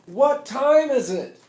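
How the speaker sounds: loud, high, slow rally yell; yelling to the crowd. Retold or imitated. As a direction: whip up the crowd with a slow call. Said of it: "I start yelling".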